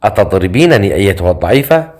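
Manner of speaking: plain reading voice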